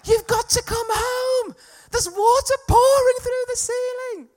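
high, drawn-out yell down the phone; panicked voice yell. Retold or imitated. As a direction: yell an urgent message, stretching the words high. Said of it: "A voice yell, really".

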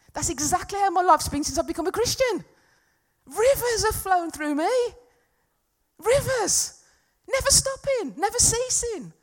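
high, loud, over-the-top elation; hammed-up shouting of an impossibly perfect testimony; mock triumphant boasting. Mock boast nobody can honestly make.

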